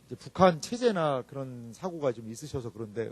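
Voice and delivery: ordinary talk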